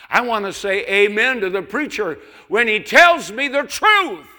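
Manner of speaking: soaring high shout on truth; preaching shout. Real, not acted. Preacher shouts to his congregation, full-voiced and high. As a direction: belt it out to the congregation. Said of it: "preacher builds to a shout".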